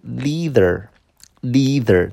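"leather" as lee-ther, slowly; LEATHER with long ee. Shown as a demonstration. Teacher correcting himself to class, "leather" as lee-ther. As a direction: stretch "leather" with an ee vowel, slowly. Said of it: "真皮这个词读".

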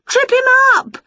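high, loud, slow shout. Acted. Witch shouting at the wrestling on telly, high and loud. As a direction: bellow it at the TV. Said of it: "she shouted".

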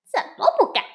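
loud and high defiant monkey; defiant answer. A narrator's reading. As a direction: toss back the dare, cocky. Wukong answers the dare.

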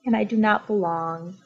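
dejected. Acted. Elephant feels left out.

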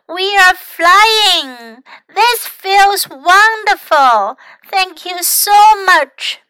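high, drawn-out cry of delight; excited cry. A narrator's reading. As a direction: call out slowly, high, with joy. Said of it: "他叫了起来".

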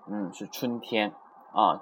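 usual speaking voice

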